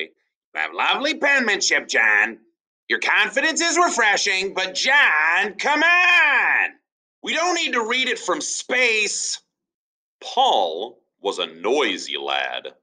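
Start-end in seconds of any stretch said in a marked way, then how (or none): 0.0-9.4 high, exasperated teacher building to a shout; a teacher losing patience with a boy's huge handwriting. Acted. Teacher scolding John, rising to a high shout. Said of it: "the teacher would say".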